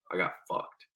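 unvoiced, soft, defeated; whispered I got fucked; whispered resignation. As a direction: breathe it out, beaten. Realizes he was cheated.

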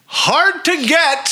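high, drawn-out incredulous echo; mock-dramatic exclamation. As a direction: stretch it out high, hammed-up. Mock-dramatic echo of cohost's plot point.

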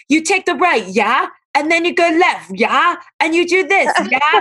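high-pitched mock British direction-giver; imitating British people. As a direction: mimic British speakers with a lilting tag question. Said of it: "drove me crazy about British people".

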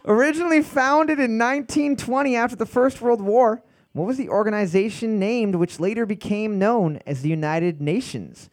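bright quiz host reading. Acted. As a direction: read it like a trivia host. Trivia host reading question.

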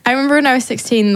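ordinary delivery

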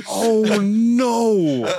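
high, loud wail of dismay; hammed-up horrified cry; mock horror. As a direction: wail it, replaying your own dread. Retold horror at his blunder.

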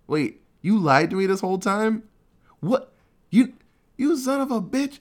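a hammed-up scolding of the liar; retold angry outburst. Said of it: "just the riot act".

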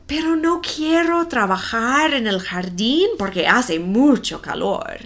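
ordinary talk

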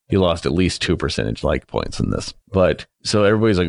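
usual speaking voice

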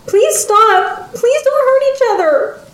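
put-on very high childlike wail; high-pitched, tearful-style pleading; sobbing plea. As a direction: beg as a distraught little girl. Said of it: "Adelina sobbed loudly".

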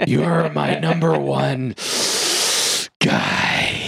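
slow breathy gangster impression; breathy, drawn-out crime boss voice; Jack Palance voice. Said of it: "complete with Jack Palance's breathy voice".

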